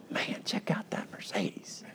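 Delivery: slow, breathless whisper of envy; awed whisper. Retold or imitated. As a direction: whisper it like you can't believe that car. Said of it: "But I might be going".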